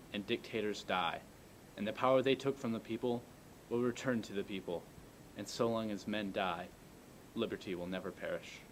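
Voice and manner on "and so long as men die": ordinary talk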